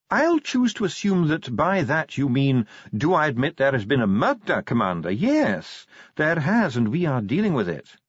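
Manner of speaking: high-pitched, pointedly patient official; composed dwarf spokesman. Acted. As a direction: concede it with lofty precision. Dwarf answers Vimes's challenge.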